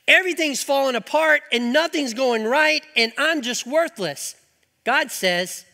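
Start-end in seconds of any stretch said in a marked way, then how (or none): 0.0-4.3 mock despairing rant.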